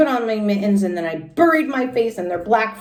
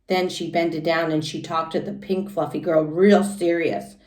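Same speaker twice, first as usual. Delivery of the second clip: usual speaking voice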